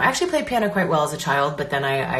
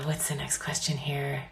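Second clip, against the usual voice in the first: whispered aside to herself; whispering; 9 dB quieter than usual. Real, not acted. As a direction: whisper it under breath. Whispering aside reading questions.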